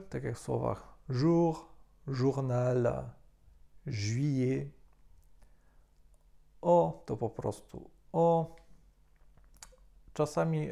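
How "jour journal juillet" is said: "jour", "journal", "juillet" with J as Ż; teacher showing French J; French pronunciation. Shown as a demonstration. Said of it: "jako Ż".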